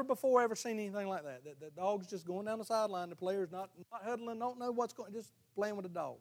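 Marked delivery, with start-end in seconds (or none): none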